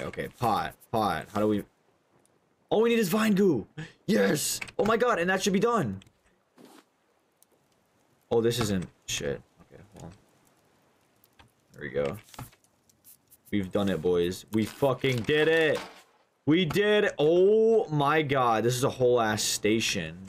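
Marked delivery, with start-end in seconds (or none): 2.7-6.0 giddy relief. Real, not acted. Relieved progress building station. 14.5-18.6 giddy relief. Real, not acted. Celebrating finished station.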